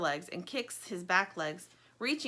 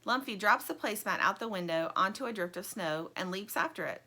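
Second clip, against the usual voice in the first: ordinary delivery, nothing marked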